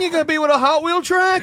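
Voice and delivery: high, mock stand-up question through laughter; sitcom comedian parody. Riffing on Seinfeld with friends, hammed-up comic voice.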